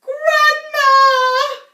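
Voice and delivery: high, loud shrieking cry. A narrator's reading. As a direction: shriek it in alarm. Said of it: "shrieked Missus Cranky".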